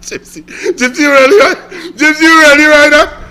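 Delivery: high, loud laughing wail; laugh-cry in disbelief. Real, not acted.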